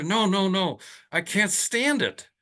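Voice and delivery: a hammed-up, high, whiny patient begging for help; desperate plea. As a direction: beg as if at the end of your rope.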